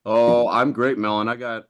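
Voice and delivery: usual speaking voice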